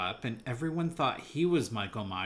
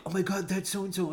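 higher-pitched, fully voiced pretend whisper; excited mock whisper; 9 semitones higher, 3 dB louder, faster than usual. Moviegoer telling a friend who's on screen, excited mock whisper. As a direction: squeal it to a friend, barely contained. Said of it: "how can I whisper to my friend".

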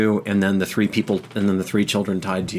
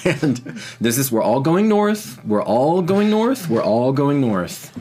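high, drawn-out call to the crowd; senior healer; 7 semitones higher, 4 dB louder than usual. Acted. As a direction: herd everyone along in a raised, pitched-up voice. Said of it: "the senior healer on duty says".

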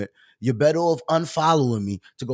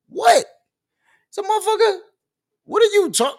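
high-pitched, loud incredulous shout; yelling comeback; 7 dB louder than usual. Retold or imitated. Streamer snapping back at a commenter who accused him, yelling. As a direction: fire back in a loud, shrieking-high disbelief. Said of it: "Why are you yelling at me?".

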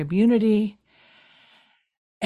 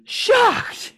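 hammed-up outrage; 7 semitones higher, 4 dB louder than usual. Mock-outraged acting as Cam.

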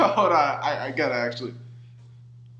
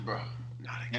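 weary mumble; 6 semitones lower, 14 dB quieter, much faster than usual. Retold or imitated. Shiloh voiced, weary.

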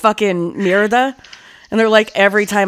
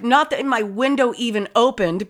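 normal talking voice

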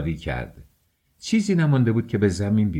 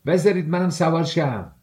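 higher-pitched eager call, lightly shouted; 7 semitones higher than usual. A narrator's reading. Young man asking to join the riders, calling out. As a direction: call out at a raised pitch, mildly. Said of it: "فریاد زد".

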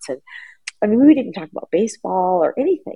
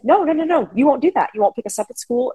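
imitating an upset child's high-pitched protest; mock-angry, high-pitched string of refusals; mad boy; 7 semitones higher than usual. Son objecting to his mother's name change, retold mad. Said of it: "my boy got really mad".

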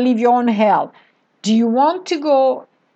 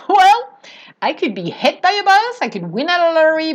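higher and louder, carefree and bubbly; playful answer to the five-year-plan question, higher and louder; breezy excited answer; 4 semitones higher, 3 dB louder than usual. Retold or imitated. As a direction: toss it off like the future is a fun game. Replays her breezy interview answer.

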